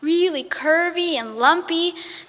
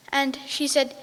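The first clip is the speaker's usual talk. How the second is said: ordinary delivery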